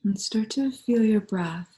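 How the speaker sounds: calm, unhurried meditation voice with long pauses; guided meditation. Real, not acted. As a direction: guide them gently into stillness, leaving space between phrases.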